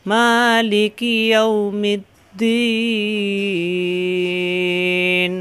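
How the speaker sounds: slow melodic chanted recitation of al-Fatiha; Quran recitation. Shown as a demonstration. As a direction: chant the whole surah with long drawn vowels. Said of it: "আমরা আরেকবার পড়ি।".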